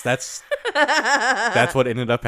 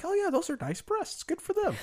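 high, light, breezy approval; 7 dB quieter than usual. Pretend. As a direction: toss it off in a high airy voice. Voiced own reaction, high.

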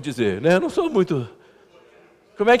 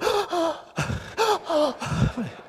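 high, sharp mock gasp of shock; 11 semitones higher, 5 dB quieter than usual. Pastor acting out his own shock at his wife's teasing, mock gasp. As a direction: gasp as if caught out. Wife mocks his crying.

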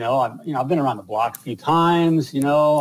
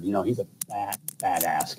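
hushed; 6 semitones lower, 8 dB quieter than usual. Real, not acted. Hushed swear on air.

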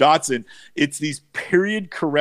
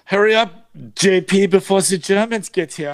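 lightly put-on German accent, pitched up; bad German accent; 4 semitones higher, 3 dB louder than usual. Urging the host to hurry before Germans arrive, in a bad German accent. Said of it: "and my bad accent".

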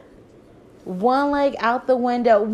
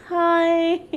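awkward high hi; 9 semitones higher, 4 dB louder than usual. Retold or imitated. Awkward greeting to family.

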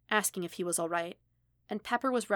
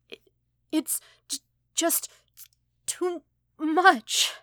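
high, loud tearful stammer; crying; 10 semitones higher, 8 dB louder, slower than usual. Acted. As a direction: sob the apology out, stumbling over every word. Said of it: "hiccuping violently now, shaking from both his sobs".

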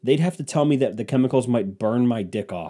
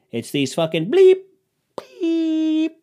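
put-on loud, high old-timey guy with self-made bleeps; 6 dB louder, slower than usual. Old-timey guy blaming with censored words, high bleeping voice. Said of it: "old-timey guy voice".

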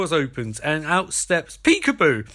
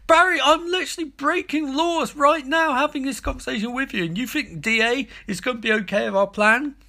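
exasperated; 9 semitones higher than usual. Retold or imitated. Joe exasperated at Barry's plan.

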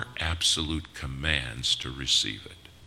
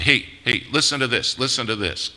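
much higher and louder, insistent calls; urgent attention-grabbing; 9 semitones higher, 8 dB louder than usual. Acted. The world clamoring for people's ears, urgent and loud. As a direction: hawk it like someone demanding an ear. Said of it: "That's why they say".

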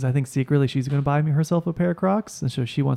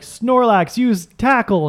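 louder, higher, hammed-up trainer call; mock battle command; 8 semitones higher, 4 dB louder, much slower than usual. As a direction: bark it like a Pokemon trainer. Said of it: "I'd be like".

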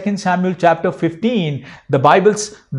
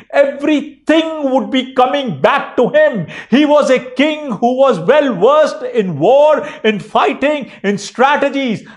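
full-force preaching; 8 semitones higher than usual. Acted. Pastor preaching to the church about God giving all, high and impassioned. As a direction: preach it out with rising fervor.

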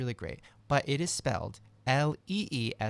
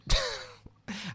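laugh. Real, not acted. Laughs at own joke.

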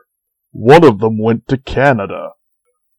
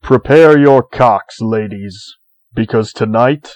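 announcer voice; 4 dB louder than usual. Acted. Show announcer intro.

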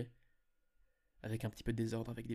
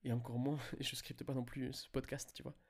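ordinary delivery

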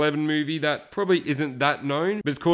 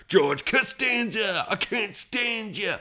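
slow, higher put-on voice quoting the film; movie character impression; 7 semitones higher, slower than usual. Quoting Shallow Hal's friend, slow higher put-on voice. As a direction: drag it out in a different voice, higher and slow.

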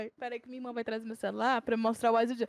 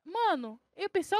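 ordinary talk

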